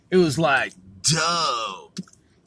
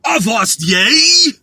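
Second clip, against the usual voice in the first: pirate call; 7 semitones higher, 9 dB louder, slower than usual. Pretend. Trying pirate calls.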